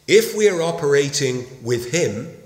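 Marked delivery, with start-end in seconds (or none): 1.6-2.4 WITH stressed in "with him". Real, not acted. Preacher stressing partnership with Christ, emphasis on WITH. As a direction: lean on the word WITH. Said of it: "I lay emphasis on the word with him".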